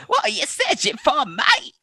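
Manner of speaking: hammed-up, high British accent; James Buckley, British accent. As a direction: shout it up high as a mock Brit. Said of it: "my British accent is flawless".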